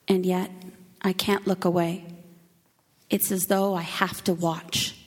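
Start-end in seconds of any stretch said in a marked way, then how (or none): none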